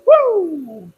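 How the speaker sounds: loud, high whoop of relief; excited cheer. Real, not acted. Owner cheering as the gelato case powers on, excited. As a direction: celebrate the case working. Display case finally turns on.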